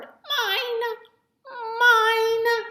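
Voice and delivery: very high, loud troll squeal; high greedy troll cry; clamoring trolls. A narrator's reading. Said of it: "they clamored".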